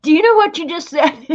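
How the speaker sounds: high, loud mock exasperation; imitated frustration. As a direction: act out a frustrated teacher scolding a pupil. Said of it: "she'd get so frustrated with me".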